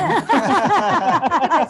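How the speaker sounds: loud, high-pitched laugh; big real belly laugh; real burst of laughter. Real, not acted. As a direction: burst out laughing loudly at a joke. Listeners joke about all-night crying.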